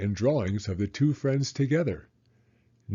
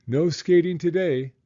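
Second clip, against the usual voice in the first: warning; 7 semitones higher, 5 dB louder than usual. A narrator's reading. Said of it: "warned Mister Mole".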